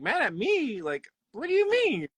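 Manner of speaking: hammed-up baffled disbelief; mock confusion climbing in pitch; retold confused surprise. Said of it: "I'm like".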